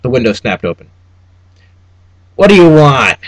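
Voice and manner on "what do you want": sharp, louder clerk's demand; loud weaselly clerk asking what you want. A narrator's reading. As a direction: snap it out like a petty official. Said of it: "demanded a weaselly man".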